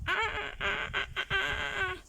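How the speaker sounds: high, hammed-up ouch ah ah ah; high yelps of pain. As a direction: yelp like ants are biting. Leopard dragged through biting ants.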